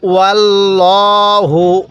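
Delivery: slow recitation, long vowels in ওয়াল্লাহু; nasalised recitation. Shown as a demonstration. Teacher showing the small mim, nasal-held recitation. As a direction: hum the final m of মুহিতুম. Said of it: "এই মিমটি আমরা গুন্নাহের সহিত পড়ব".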